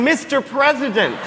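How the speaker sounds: a repeated call to catch his eye; yelling again. Retold or imitated. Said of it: "I was literally yelling".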